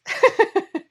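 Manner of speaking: loud high embarrassed laugh. Real, not acted. I'm embarrassed.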